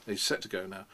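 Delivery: usual speaking voice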